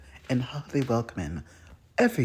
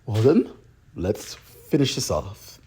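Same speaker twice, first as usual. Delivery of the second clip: normal talking voice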